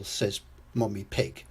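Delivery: plain reading voice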